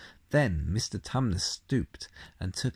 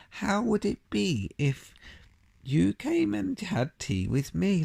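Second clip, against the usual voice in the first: faun invitation; 8 semitones higher than usual. A narrator's reading.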